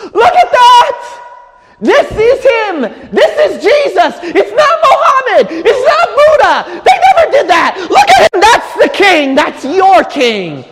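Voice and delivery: high, loud preaching shout; impassioned outcry. Acted. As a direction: cry out and point them to their king. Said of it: "when you see that bleeding Savior to go".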